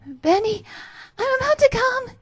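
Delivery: very high, loud breathless cry. Acted. Alice near the edge, calling out very high and loud.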